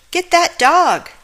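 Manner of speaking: high, loud, slow yell. A narrator's reading. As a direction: bellow after the dog. Witch chasing dog.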